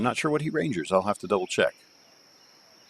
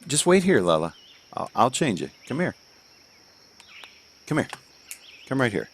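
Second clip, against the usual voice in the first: higher, coaxing call; 5 semitones higher than usual. Real, not acted. Father calls the toddler to be changed.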